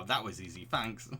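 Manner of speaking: ordinary talk, nothing marked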